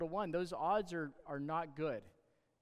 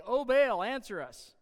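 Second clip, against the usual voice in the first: sudden loud high call to a god, then dropping back; desperate cry; 7 semitones higher, 9 dB louder, slower than usual. Acted. Prophets begging Baal, a loud pleading cry. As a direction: cry out to the idol with everything.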